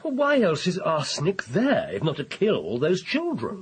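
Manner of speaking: insistent challenge. Acted. Arguing his poisoning theory against objection.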